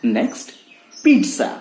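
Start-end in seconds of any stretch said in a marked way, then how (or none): 1.1-1.5 "pizza" as PEET-sa, high; TS pronunciation. Shown as a demonstration. Teacher giving the correct form, TS pronunciation. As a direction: say "pizza" with a crisp T-S. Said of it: "ना कि पिज्जा".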